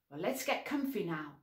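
usual speaking voice

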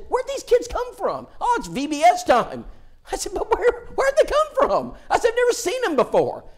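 put-on can't believe all these kids; young preacher asking an elder, amazed and high. Retells his amazement to elder.

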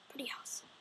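breathy aside "Pretty awesome"; voiceless gleeful aside; whisper. Acted. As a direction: whisper it to yourself. Said then whispered.

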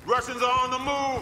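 battle warning yelled slowly; warning shout. Acted. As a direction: call out the enemy's move. Calling out in combat.

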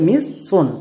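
"মিস সোন" as mis-son, two s sounds; wrong double s. Shown as a demonstration. Teacher shows students' misreading, double-s "মিস সোন". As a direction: break "মিস সোন" after the first s. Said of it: "দুটো এস ধরে নিচ্ছ".

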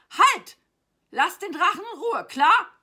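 high, very loud protective order; indignant acted shout; shouted command. A narrator's reading. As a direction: yell at an attacker to back off. Boy orders knight to stop.

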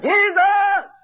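high, drawn-out call; crying out. Retold or imitated. Blind beggar calls to Jesus over the crowd, crying out. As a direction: call out for help from afar. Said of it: "he began to cry out".

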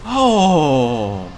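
ecstatic shout. Real, not acted. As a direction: roar it out in disbelieving joy. Boss finally dies. (esultanza incredula)

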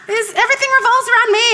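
ordinary delivery

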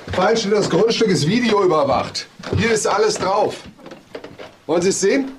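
corner him with the video; pressing confrontation. Acted. Inspector confronting Gaußmann with the video, pressing.

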